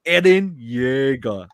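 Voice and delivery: slow, drawn-out mock anime delivery; anime response. Recruiter answering callers with a slow mock anime voice. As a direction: say it like an anime hero, deadpan. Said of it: "anime response".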